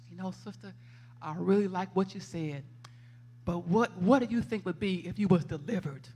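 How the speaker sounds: imitating a calm, careful counselor; tactful pastor. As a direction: gently let her down, quietly. Retold pastor's gentle reply.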